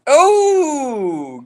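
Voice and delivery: sudden high oh; startled, high and loud; surprised oh. Real, not acted. Sudden exclamation.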